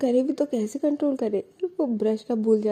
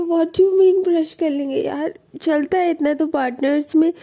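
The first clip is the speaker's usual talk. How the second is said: higher, louder indignant complaint; protest, hurt and annoyed; offended partner raising her voice; 8 semitones higher, 6 dB louder than usual. Acted. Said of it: "Alisha को ये बात बुरी लग जाती है".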